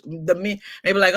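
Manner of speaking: ordinary talk, nothing marked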